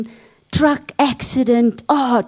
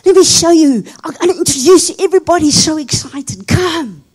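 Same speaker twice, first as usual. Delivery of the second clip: higher, lively, eager welcome; excited father; 5 semitones higher than usual. Retold or imitated. Father excitedly welcomes his son in heaven. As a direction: greet your boy with eager joy. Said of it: "his father was so excited".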